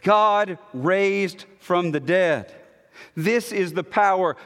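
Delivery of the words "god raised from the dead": slow, "God" punched and held; stress on God. Shown as a demonstration. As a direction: hit "God" hard, then proceed slowly. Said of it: "Emphatic here is God".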